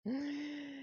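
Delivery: soft high wheezing giggle; laughing. Real, not acted. After joking about Daryl, laughing. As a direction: let a quiet laugh tail off.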